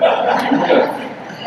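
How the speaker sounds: slow, breathy laugh through the words; nervous laughing go-ahead; laughing resolve. Real, not acted. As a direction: laugh it out, committing anyway.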